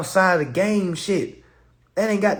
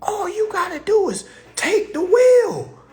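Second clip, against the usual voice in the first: emphatic; 14 semitones higher, 5 dB louder than usual. Real, not acted. Emphatic punchline.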